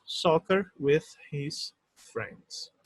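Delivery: very slow, careful English example; slow model reading. Shown as a demonstration. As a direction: spell it out slowly for students. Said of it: "professor lê a frase modelo". (leitura bem lenta e pausada)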